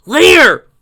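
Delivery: loud high yell; shouted brush-off. A narrator's reading. Fleeing warrior yelling at companion, loud and high. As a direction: bark it over your shoulder.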